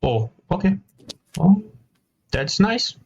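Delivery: ordinary delivery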